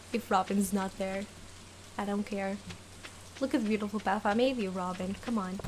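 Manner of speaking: ordinary talk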